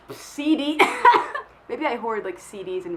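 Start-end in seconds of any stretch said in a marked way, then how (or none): 0.8-1.4 high burst of laughter. Real, not acted. Laughs after answering C D.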